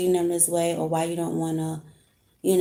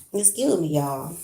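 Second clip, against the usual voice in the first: ordinary talk, nothing marked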